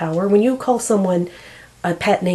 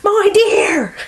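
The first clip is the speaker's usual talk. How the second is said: put-on Newfoundland accent; 8 semitones higher, 5 dB louder, much slower than usual. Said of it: "I wish I could do the accent".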